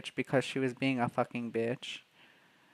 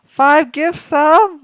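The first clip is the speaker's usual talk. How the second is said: much higher and louder, stretched out; stunned squeal; 14 semitones higher, 15 dB louder, much slower than usual. Real, not acted. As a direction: gasp it out in disbelief. Stunned by big gift.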